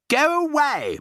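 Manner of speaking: high, loud, shrill snob; shrill haughty lady. A narrator's reading. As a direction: shriek it at her. Mrs Jenkins shrill dismissal.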